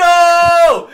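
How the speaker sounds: loud high cry of loss; shouting after a lost beanie; imitated yell. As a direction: yell it like the hat just flew off. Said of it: "and then goes".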